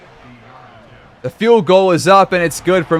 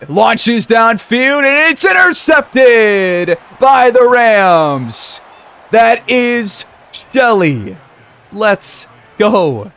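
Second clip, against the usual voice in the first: high, stretched-out interception call; ecstatic play-by-play shout; 6 semitones higher, much slower than usual. Real, not acted. Commentator celebrates the Rams' interception, ecstatic drawn-out shout. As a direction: drag out the words in a high, triumphant cry. Calling his team's interception live.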